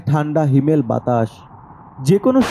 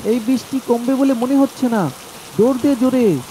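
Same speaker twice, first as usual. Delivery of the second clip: raised, higher voice urging his friend to run; calling out in the storm; 6 semitones higher than usual. Acted. Abdul yells to Rahim to run through the storm, urgent call. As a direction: call out over the rain, urgent.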